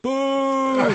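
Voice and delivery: a loud jeering boo; mock boo. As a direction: boo him like a heckler in the crowd. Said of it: "he goes".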